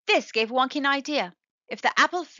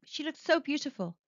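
plain reading voice

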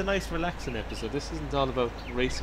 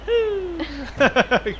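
whooping cheer; 14 semitones higher, 5 dB louder than usual. Real, not acted. Cheering jumping out of car.

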